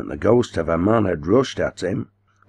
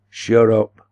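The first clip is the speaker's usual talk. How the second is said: higher, louder cry; 5 semitones higher, 6 dB louder than usual. A narrator's reading. Old man silencing the boss, crying out. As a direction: cry out an abrupt order. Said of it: "cried the old man".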